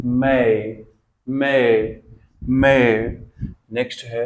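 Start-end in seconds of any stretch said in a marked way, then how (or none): 0.0-2.8 "मै" said loudly as may with an ei glide; loud repeated model. Shown as a demonstration. Teacher drilling a word for the class, building louder. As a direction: build "मै" louder each time. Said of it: "इसको क्या बोलेंगे हम?".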